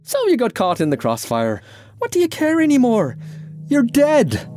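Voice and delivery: climbing to a very high pitch; heated retort. Acted. Irishman and Francis quarrel over death, heated.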